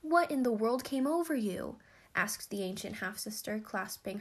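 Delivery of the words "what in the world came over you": higher, louder, bewildered question; astonished concern. A narrator's reading. Half-sister to her recovering lover, astonished question. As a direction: ask in amazed concern.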